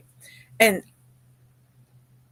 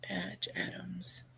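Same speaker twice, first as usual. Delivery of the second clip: barely breathed Patch Adams while writing; whispered to herself; 17 dB quieter, slower than usual. Real, not acted. Noting the movie title, whispering Patch Adams to herself. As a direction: whisper Patch Adams slowly as she writes it down. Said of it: "let me write that down".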